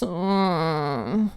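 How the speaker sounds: a lazy, unconvincing fake sob; mock whimper; half-hearted fake cry. A narrator's reading. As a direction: pretend to cry without trying. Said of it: "She made a sound like crying, but with not much effort".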